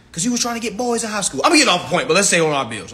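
ordinary talk, nothing marked

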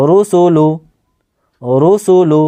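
"রুসুলু" as one measured, evenly sounded word; pronunciation demonstration. Shown as a demonstration. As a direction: model "রুসুলু" carefully for learners, falling at the end. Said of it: "এবারে উচ্চারণ দেখেন". ("রুসুলু" স্পষ্ট উচ্চারণ করে দেখানো)

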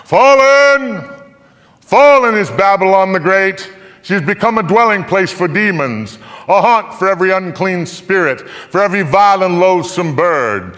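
loud, high herald's cry, easing after the opening; ringing announcement of a city's fall; mighty proclamation. A narrator's reading. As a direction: proclaim it aloud like a mighty angel. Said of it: "in a mighty voice he proclaimed aloud".

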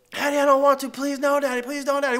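put-on pleading little girl. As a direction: imitate a nervous kid pleading. Daughter begging at slide top.

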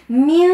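من with the nun hidden into a nasal an; nasal من, no clear n. Shown as a demonstration. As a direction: sing من with a French nasal ending. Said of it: "هيك الصوت أنا حاجتي بيه".

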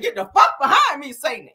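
loud, high, hammed-up rebuke; mock testifying rebuke. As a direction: blast "Get the fuck behind me", dropping on "Satan". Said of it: "You don't tell Satan to get the fuck behind you".

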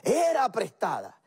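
high-pitched outcry at normal volume; restrained, high mock yell; acted cry of dismay. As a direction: re-enact a desperate cry to the master. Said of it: "pegó un grito".

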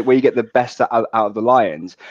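usual speaking voice

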